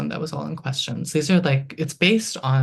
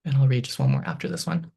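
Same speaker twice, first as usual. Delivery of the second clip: ordinary delivery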